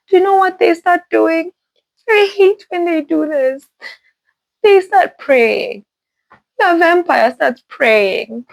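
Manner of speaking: high-pitched, incredulous complaint; exasperated disbelief. Real, not acted. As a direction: vent outrage at the story, stretching the words. Outraged at vampires praying.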